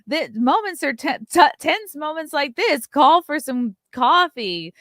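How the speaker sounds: hammed-up super chat readout; imitated coffee pitch. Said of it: "he sent a super chat that was like".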